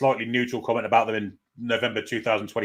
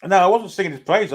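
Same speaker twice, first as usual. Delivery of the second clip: indignant denial; 7 semitones higher, 7 dB louder than usual. Real, not acted. Denying it to his co-host.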